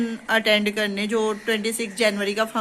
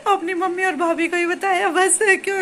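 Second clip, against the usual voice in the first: high voice squeezed by laughter; laughing confession; 7 semitones higher than usual. Real, not acted. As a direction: admit it while giggling helplessly. Giggling confession about whom she told. (हँसते-हँसते, ऊँची आवाज़ में)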